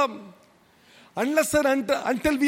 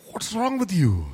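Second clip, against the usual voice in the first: lightly voiced wife, exasperated; a hint of the wife asking what's wrong; wife scolding her moody husband, lightly imitated; mimicking wife; 4 semitones lower than usual. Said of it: "She's like".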